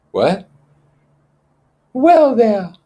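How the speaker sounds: put-on high, loud fussy boy; Piggy's voice. Piggy pressing Ralph about the conch. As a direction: push the point home.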